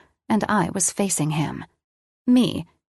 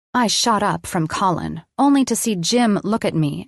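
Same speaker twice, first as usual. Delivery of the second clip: usual speaking voice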